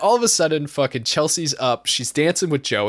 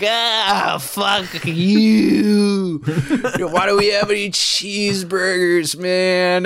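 lightly voiced stoned teen, a bit higher; a loose, higher mock of a stoned kid; Louis mocked; 5 semitones higher, 4 dB louder, slower than usual. Friend in the corner, mildly mimicked higher. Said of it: "Louis is in the corner, just like".

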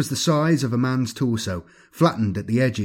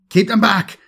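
loud, high-pitched battle yell; shouted order; 10 semitones higher, 7 dB louder than usual. A narrator's reading. Egil to comrades holding off the scarabs, shouting. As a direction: shout it over the fighting. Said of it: "shouted Egil".

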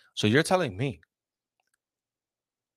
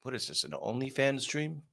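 normal talking voice

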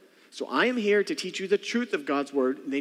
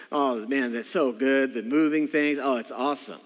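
ordinary delivery

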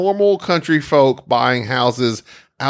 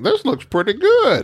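mocking house buyer; 10 semitones higher than usual. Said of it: "And they're like".

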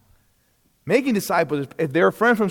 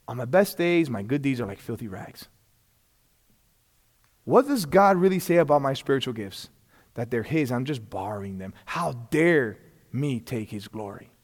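humbled inner voice; 8 semitones lower, 6 dB quieter than usual. Retold or imitated. Preacher voicing his own humble prayer, hushed. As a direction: confess it quietly to yourself. Humbled self-talk before God.

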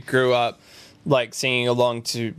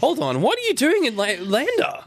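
much higher, laughing disbelief; baffled shopkeeper; 7 semitones higher than usual. Retold or imitated. Wyoming shop clerk to Australian visitor, high laughing disbelief. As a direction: squeal it like a stunned small-town clerk. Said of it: "they were like".

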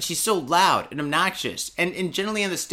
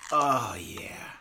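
whispered oh yeah; 3 semitones lower, 4 dB quieter than usual. Real, not acted. Opening the drink.

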